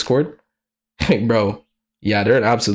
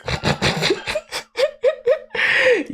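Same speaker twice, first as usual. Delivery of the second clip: squealing high giggle; high laugh. Real, not acted.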